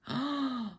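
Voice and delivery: sharp high gasp of fear; frightened gasp. A narrator's reading. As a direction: catch your breath in sudden fright. Children frightened by voices outside.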